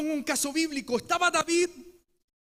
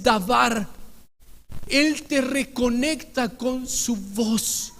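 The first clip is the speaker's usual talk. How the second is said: emphatic preaching; 6 dB louder than usual. Acted.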